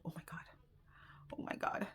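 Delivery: whispered, breathless disbelief; stunned whisper. Retold or imitated. Learning she was scammed, a stunned whisper. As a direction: barely voice it, sinking in.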